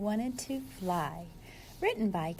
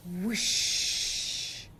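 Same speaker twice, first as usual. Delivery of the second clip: whoosh; 4 semitones lower than usual. Acted. Sliding down the ice.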